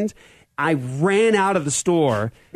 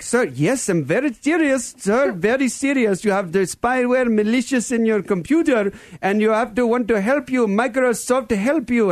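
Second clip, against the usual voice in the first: put-on high-pitched Indian-accented scam caller; earnest Indian call-centre impersonation, higher voice; 7 semitones higher than usual. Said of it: "with a strange Indian accent".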